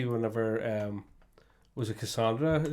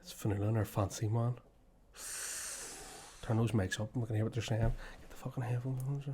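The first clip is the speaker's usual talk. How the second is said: soft, hushed, playful eavesdropping; 3 semitones lower, 9 dB quieter than usual. Real, not acted. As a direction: keep it down, conspiratorial.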